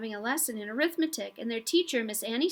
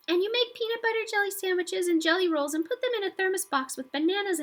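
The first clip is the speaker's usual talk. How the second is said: put-on little girl's high voice, held higher all through; small high voice; 9 semitones higher than usual. A narrator's reading. Jenny eagerly explains a catastrophe in a small high voice. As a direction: speak up small and high like a tiny girl. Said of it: "In a very small, high voice".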